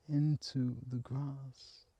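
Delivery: usual speaking voice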